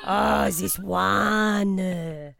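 lightly hammed French accent; a comic Frenchman being interviewed. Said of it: "Pierre Jambon".

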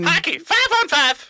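high put-on phone-character voice; Tony Zamboni. Tony explaining hockey to the callers, high character voice. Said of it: "you're gonna have to explain".

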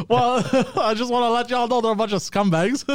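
high voice through laughter; real laughing. Real, not acted. Podcaster letting listeners know about the scumbags, laughing. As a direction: say it while cracking up. Laughs while calling out the sponsor.

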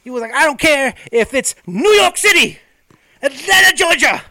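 high, loud wrestling promo shout; rising promo yell naming cities; Ric Flair. Retold or imitated. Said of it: "So he'd be like".

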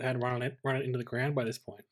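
usual speaking voice

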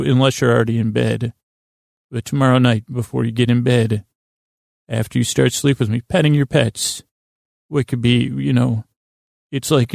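normal talking voice

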